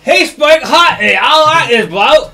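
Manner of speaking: loud, high mock-British yob; hammed-up British lout, shouted; put-on British accent. Player mimicking the barbarians, rowdy British accent. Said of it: "you're messing up my accent".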